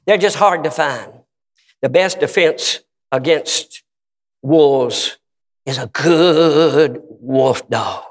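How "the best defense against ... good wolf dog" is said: drawn-out words with pauses between; slow, weighty maxim. Real, not acted. Preacher driving home his point, slow deliberate delivery. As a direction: stretch it out slowly for weight.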